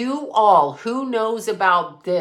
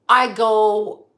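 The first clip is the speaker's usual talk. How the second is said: ordinary talk, nothing marked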